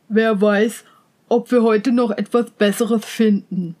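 a hint of a low-voiced manservant; servant's voice. A narrator's reading. Servant musing over the raven, lightly acted low voice. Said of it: "sagte der Diener".

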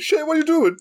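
high panicked mom. Retold or imitated. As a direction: shriek it like a startled mom. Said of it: "Your mom pokes her head in the door. Like".

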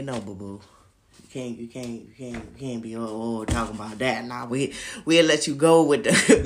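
low, patronising mock judge voice. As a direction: play the judge brushing him off. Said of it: "The judge say".

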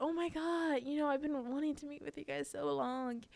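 high-pitched imitation of a delighted grandmother; imitated gushing delight. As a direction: gush it, voice held up high. Grandmother gushes to the missionaries.

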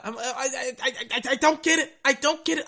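stuck on the first word, high and baffled; high, stumbling repeated starts; stuttering. Real, not acted. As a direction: stammer in real exasperated disbelief. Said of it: "I'm literally stuttering at the thought".

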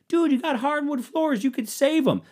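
high, mocking know-it-all voice. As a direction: squeak it out like a smug advice-giver. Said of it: "everybody who was like".

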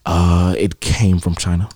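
low, slow, blunt answer; Trump impression. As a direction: drop low and drag it out. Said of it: "He said".

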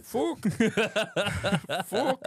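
high Irish swear, tried twice; attempting Irish. Shown as a demonstration. Trying out the Irish fan's curse, high Irish accent. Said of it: "How do Irish".

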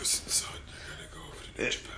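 whispered, fast and raspy. Retold or imitated. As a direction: breathe it out hoarse and fast. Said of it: "That's John Laurinaitis".

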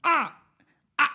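short, high, loud gorilla yells; gorilla scream. Acted. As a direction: repeat the gorilla scream in sharp bursts. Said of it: "a screaming gorilla".

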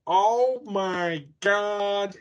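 very slow, high, loud catchphrase; Janice from Friends. Retold or imitated. Offering the episode title as a high, drawn-out sitcom impression. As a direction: stretch each word right out, high and mocking. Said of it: "[name] sounds like Janice".

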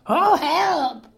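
high yelp for help; high storybook panic; frightened cry. A narrator's reading. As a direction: squeal it in alarm. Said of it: "And the other cried".

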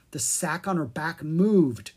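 plain reading voice

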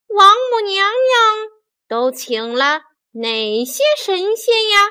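high, loud, curious monkey voice; Monkey King. A narrator's reading. Monkey King asking fairies about the guests, curious.

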